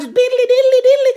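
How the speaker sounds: high diddly riff; mock guitar riff. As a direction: scat the guitar line up high.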